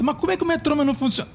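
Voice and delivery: high, fast puzzled asking; mock question. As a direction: ask it in a hurried, baffled tone. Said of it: "Aí você pergunta".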